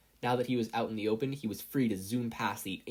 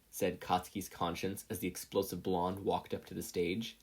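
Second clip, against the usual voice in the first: ordinary delivery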